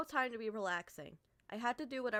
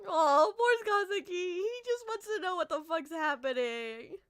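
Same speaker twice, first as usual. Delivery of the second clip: gushing pity; 7 semitones higher, 8 dB louder than usual. Real, not acted. Gushing over game character.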